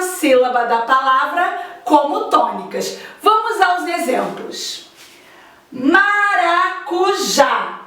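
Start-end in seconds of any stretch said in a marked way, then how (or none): 5.7-7.8 Maracujá drawn out slowly with the final já stressed; slow teacher's demo of Maracujá, stress on já; syllable by syllable. Shown as a demonstration. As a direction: stretch Maracujá, landing on the last syllable já. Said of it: "a última sílaba é o já".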